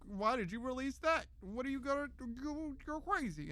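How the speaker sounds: high-pitched, drawn-out mock protest; mock outrage. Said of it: "They're gonna be like".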